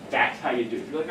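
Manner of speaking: usual speaking voice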